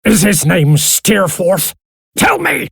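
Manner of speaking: high shouted demand, rising further; furious high-pitched interrogation; angry shout. A narrator's reading. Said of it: "Daniel shouted again".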